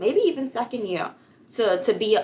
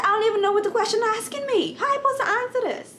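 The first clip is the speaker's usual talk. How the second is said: much higher, fast, panicked questions; frantic retold plea; 14 semitones higher, much faster than usual. Student begging God for help, high and rushed. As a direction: race through it like a lost student.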